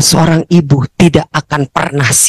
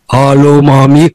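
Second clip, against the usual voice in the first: lower, man's deep-ish greeting; son's grown-man voice; 5 semitones lower, 3 dB louder, slower than usual. Retold or imitated. Son answering his mother's call, lower grown-man voice. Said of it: "suaranya udah kayak om-om".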